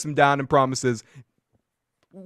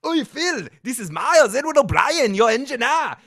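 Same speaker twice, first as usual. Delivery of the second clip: higher, rough Scottish engineer griping; Scottish accent; 6 semitones higher than usual. Retold or imitated. Host replays old podcast engineer bit, in a Scottish accent. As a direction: bark at him in a Scottish accent. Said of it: "did a Scotty voice".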